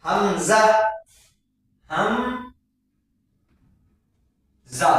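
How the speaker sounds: "हमजा" then "हम" "जा" split apart slowly; split slow hamza. Shown as a demonstration. Teacher to students: hamza split into parts. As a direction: break "हमजा" into "हम" and a z "जा". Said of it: "ये जा है".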